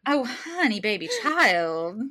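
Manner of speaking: high, drawn-out Southern sweetness; customer service voice. Retold or imitated. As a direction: coo it like a syrupy Southern lady. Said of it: "my customer service voice".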